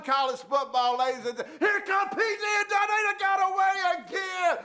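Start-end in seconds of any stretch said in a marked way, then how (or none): 1.6-4.6 hammed-up shout. Radio host hollering for security to drag a caller off. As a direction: bellow for the guards like a showman.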